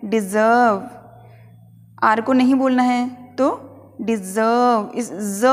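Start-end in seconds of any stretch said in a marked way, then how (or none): 0.1-0.9 डिजर्व said slowly, no r sound; slow डिजर्व, drop the r; careful pronunciation. Shown as a demonstration. Teacher slowly demonstrates डिजर्व without the r. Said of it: "इसे बोलना है हमें". 2.0-4.9 डिजर्व slowly, ज़ leaned on, no r; stress on ज़. Shown as a demonstration. Teacher explains stress while slowly modelling डिजर्व. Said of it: "पे थोड़ा stress देना है".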